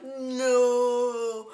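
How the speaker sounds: long, drawn-out mock "no"; slow-motion cry. Watching camera fall off the table, slow-motion cry. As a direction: stretch it out like a slowed-down film. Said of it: "in slow motion".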